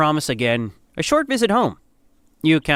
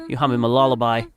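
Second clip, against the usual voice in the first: usual speaking voice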